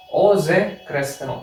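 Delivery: ओ ज़ेक्रस्काओ said slowly, each part clear; teacher dictating ओ ज़ेक्रस्काओ; slow spelled-out demo. Shown as a demonstration. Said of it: "इसको मैं लिख नहीं रहा, आप लिख लीजिए".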